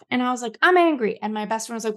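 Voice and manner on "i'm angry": higher, louder mock outburst; retold anger. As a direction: re-enact declaring your anger, pitched up. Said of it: "I was just pissed off".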